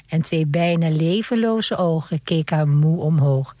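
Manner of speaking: usual speaking voice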